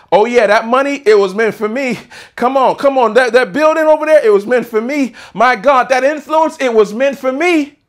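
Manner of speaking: high, loud rallying preacher's shout; full-force preaching. Acted. Preacher leading the church to shout it was meant for them, full-force preaching. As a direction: shout it out to rouse the congregation.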